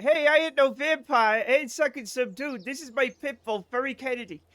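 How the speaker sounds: put-on high-pitched Boston accent; Boston Matt. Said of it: "Boston Matt".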